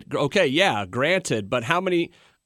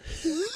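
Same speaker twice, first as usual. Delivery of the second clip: very high squeaky clown giggle; Krusty the Clown laugh; 9 dB quieter than usual. Retold or imitated. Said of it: "Krusty the Clown?".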